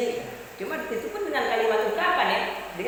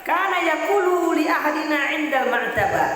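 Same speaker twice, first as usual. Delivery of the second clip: louder recitation of the Arabic line; raised chanted Arabic; Arabic hadith recitation; 5 semitones higher, 6 dB louder than usual. Retold or imitated. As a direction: declaim the hadith a touch higher and louder. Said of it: "Dengan berkah".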